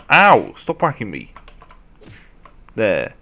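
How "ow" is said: loud, high "ow"; sharp cry at being hit; pained yelp. Real, not acted. Player whacked by a zombie, yelping.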